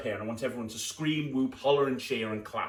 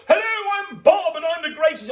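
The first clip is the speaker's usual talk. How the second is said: much higher, loud boastful greeting; hammed-up showman; 10 dB louder than usual. Shown as a demonstration. Magician showing kids how to introduce themselves, high and loud. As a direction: puff yourself up as Bob. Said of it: "you'd go".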